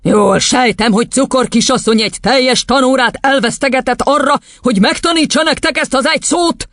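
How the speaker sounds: high, hectoring question; tyrant headmistress, indignant. Acted. Headmistress questioning a wasted lesson, indignant. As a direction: interrogate the class up high.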